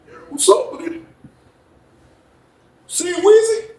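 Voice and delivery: high, soft mouthed greeting; high soft voiced Jeff; mimicking Jeff. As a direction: imitate a guy mouthing across the yard. Voicing Jeff's mouthed words.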